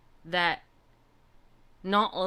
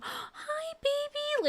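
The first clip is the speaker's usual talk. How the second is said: put-on baby talk to kitten; much slower than usual. Greeting the shy kitten, much higher cooing baby talk. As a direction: coo it small and cute. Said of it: "I go".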